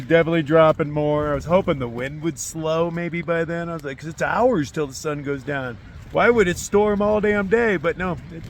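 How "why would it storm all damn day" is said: exasperated. Real, not acted. Fed up with all-day storm.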